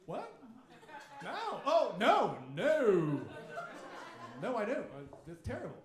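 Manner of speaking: loud, rising oh no; sudden horrified realization, hammed up; mock dawning shock. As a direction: caught out, loud no no. Performing belated shock.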